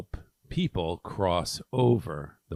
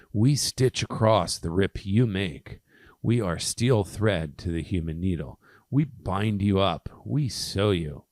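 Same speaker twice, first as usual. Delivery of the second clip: emphatic, proud verse recital; poem reading; 5 dB louder than usual. Acted. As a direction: declaim the verse with punched key words. Reads a poem aloud.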